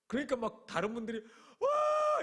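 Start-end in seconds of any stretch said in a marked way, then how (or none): 1.6-2.2 high, loud startled "wah!"; startled crowd cry. Retold or imitated. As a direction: shriek of shock. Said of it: "이랬어요". (깜짝 놀라 높게 외치는 "와!")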